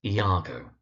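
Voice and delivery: Iago said ee-AH-go; correct pronunciation. Shown as a demonstration. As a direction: open Iago with an "ee", then AH-go. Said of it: "name is most frequently mispronounced".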